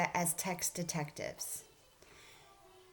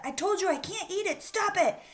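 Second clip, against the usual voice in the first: put-on angry child yelling, much higher and louder; mad; 10 semitones higher, 5 dB louder than usual. Christopher yelling at the classmate to stop. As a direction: snap it out like a furious kid. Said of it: "he got mad and said".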